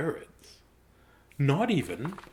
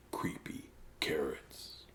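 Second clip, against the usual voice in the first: slow whispered creepy carrots; spooky whisper; 8 semitones lower, 11 dB quieter, slower than usual. Acted. Reader whispering the creepy refrain. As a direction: breathe it out eerily.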